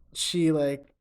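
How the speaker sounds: usual speaking voice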